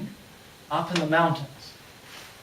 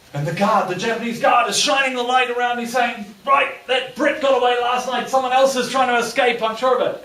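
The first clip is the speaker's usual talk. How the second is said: building excitement; 7 semitones higher, 6 dB louder than usual. A narrator's reading. Storyteller builds tension loudly.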